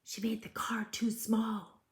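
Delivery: hushed aside; whisper. Acted. Reader whispering to children about the fairy's mistake. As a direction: whisper it like a secret.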